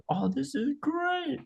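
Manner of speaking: very high, slow, soft and sweet; Jolly's happy voice. Acted. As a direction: coo it like a delighted child.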